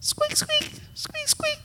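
tiny soft squeaks, very high; imitated mouse squeaking, soft and very high. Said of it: "still listening".

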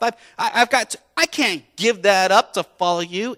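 loud, high desperate refusal; refuse, straining and loud; pleading protest. Retold or imitated. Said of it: "that young man was sad".

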